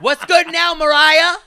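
high, loud taunting shout; diva callout. Retold or imitated. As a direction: call her out like a rival diva. Mock callout to [name].

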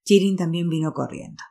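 plain reading voice

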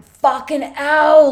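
swearing out loud while the nurse cleans the graze; pained outburst. Retold or imitated. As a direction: drag out the curse as the sting hits. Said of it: "I was in so much pain".

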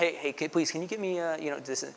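quiet, lightly voiced demanding diner; a hint of flagging down a waiter; calling a waiter. Pastor imitating a customer calling a waiter, mild. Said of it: "at the restaurant".

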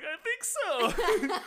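bewildered, laughing it out; mock-stunned disbelief at being asked out. Stunned reply, laughing, retold.

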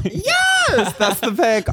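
sudden high excited yell; excited shout. Real, not acted. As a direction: burst out in delight. Guest pulls up the pic.

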